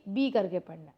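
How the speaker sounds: ordinary talk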